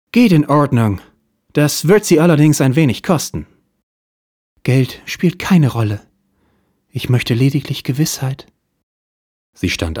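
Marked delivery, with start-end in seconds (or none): 0.1-3.5 much higher, louder put-on detective voice; hard-boiled detective. 4.6-8.5 wealthy client starting her tale; femme fatale voice. Acted.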